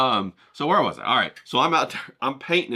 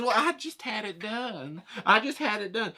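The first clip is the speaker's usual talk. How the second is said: flattered Karen; 7 semitones higher, 4 dB quieter than usual. Retold or imitated. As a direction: coo over your new hairdo. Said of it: "she says".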